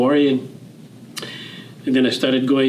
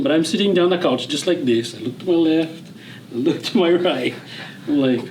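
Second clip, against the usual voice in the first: normal talking voice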